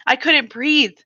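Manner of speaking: plain reading voice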